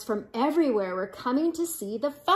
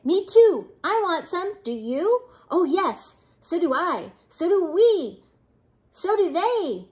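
raised, bouncy voices chiming in; eager chatter; 6 semitones higher, 4 dB louder than usual. Acted. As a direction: clamour for a share, pitch jumping up. Eager prairie dogs chime in.

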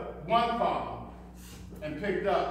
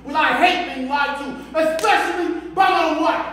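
raised, heated voice; angry confrontation; 4 semitones higher, 6 dB louder than usual. Acted. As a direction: lay down the law to a lying wife. Husband confronts lying wife.

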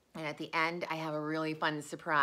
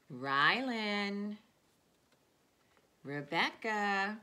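put-on slow, drawn-out naming of each child; reading to children; 4 dB louder, much slower than usual. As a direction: call each friend's name like a treat. Reads names of her students.

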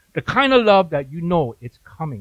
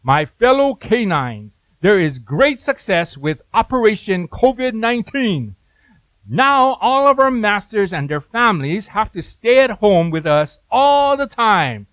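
dog addressing meeting; 4 semitones higher, 5 dB louder than usual. Acted. Dog at conference table addresses fellow canines, mock-grand announcement. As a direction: proclaim it like a dog proudly briefing the pack. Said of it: "the dog at the end of the table, right, he says".

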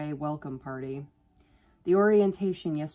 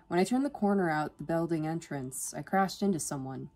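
plain reading voice

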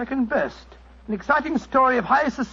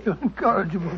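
chuckled, indulgent reproach; amused laughing; 3 semitones lower, faster than usual. Acted. Doctor to the incorrigible announcer, laughing as he speaks. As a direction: scold fondly through a laugh.

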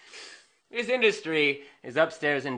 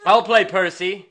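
usual speaking voice

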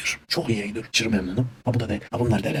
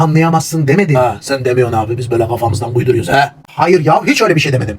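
heated denial; 3 semitones higher, 7 dB louder than usual. Acted. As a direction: defend yourself, getting heated. Acted quarrel escalates.

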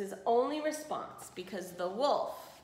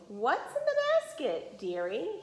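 sly wolf; 6 semitones higher than usual. A narrator's reading. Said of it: "he asked".

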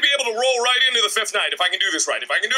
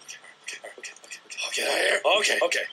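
nervous muttering under breath; scared horror game streamer; 9 dB quieter, much faster than usual. Real, not acted. Tense streamer muttering to self.

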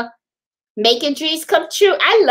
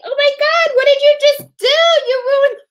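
shocked; 14 semitones higher, 4 dB louder than usual. Retold or imitated. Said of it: "I was like".

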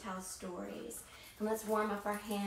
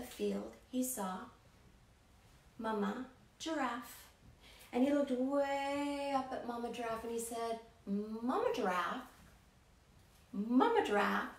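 story narration. A narrator's reading. Storyteller reading to children in an animated voice. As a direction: tell it to wide-eyed kids.

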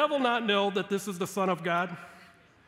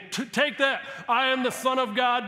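high, loud mock boast; triumphant retort; 6 semitones higher, 6 dB louder than usual. Imagined Jesus showing up the devil, triumphant. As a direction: fire it back louder and higher, showing off. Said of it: "and saying".

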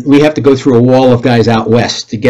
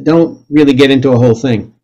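ordinary delivery

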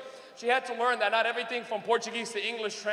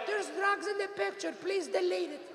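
high, soft, slow mother voice; imitated mother; 7 semitones higher, 6 dB quieter than usual. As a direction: read Mom's comment in her high put-on voice. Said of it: "she'll write on it".

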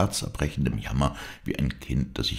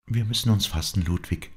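put-on higher young woman, steadying herself; higher girl's voice gathering herself; Dorette's voice; 7 semitones higher than usual.

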